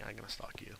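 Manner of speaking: softly whispered threat; creepy whisper. Pretend. Gamer whispering a mock threat to his fans. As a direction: whisper it like a playful stalker.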